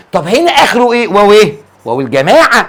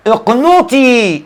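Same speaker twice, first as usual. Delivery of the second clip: higher, very slow اقنطي; drawn-out word; 4 semitones higher, much slower than usual. Shown as a demonstration. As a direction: hold the final long ي of اقنطي. Repeats word to show its ending.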